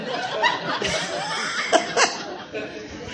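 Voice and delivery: a high, near-silent squeal of laughter; high breathless laugh. Real, not acted. Remembering his dad falling over, shrieking laughter. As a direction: lose it completely.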